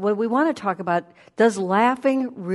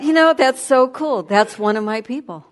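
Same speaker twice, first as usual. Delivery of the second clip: higher and louder, delighted; excited; 5 semitones higher, 7 dB louder than usual. Real, not acted. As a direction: burst out with joy. Joyful recalled thought.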